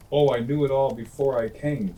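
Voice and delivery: a smug owl boasting; know-it-all owl. A narrator's reading. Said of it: "said the Owl".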